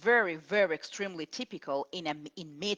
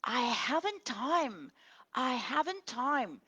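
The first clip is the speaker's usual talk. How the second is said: whispered, slow imitation of a student's error; hushed, slow mock of "I haven't time"; mock student mistake; 7 semitones higher than usual. Shown as a demonstration. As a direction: breathe out the wrong sentence like a student. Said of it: "here we cannot say".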